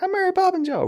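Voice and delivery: high, bright mock Yondu quote; quoting Yondu. Retelling Yondu's famous line, high and hammed-up. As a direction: pitch it way up for the silly line. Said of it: "of the, like".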